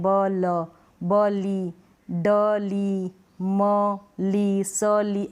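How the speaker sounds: syllables ब ली, म ली drawn out one by one; slow letter-by-letter sounding out. Shown as a demonstration. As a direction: sound out each syllable very slowly. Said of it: "ऐसे करके आप pronounce कर लेते हैं".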